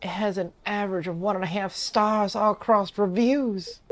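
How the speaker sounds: high-pitched, distressed British character; British accent. Acted. Musician lamenting bad reviews, high British voice. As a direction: read it as an upset British musician. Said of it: "Bro made him British".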